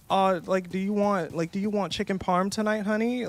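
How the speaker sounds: a hint of a doting girlfriend asking about dinner; sweet girlfriend voice. A girlfriend sweetly offers her partner chicken parm. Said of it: "It's like".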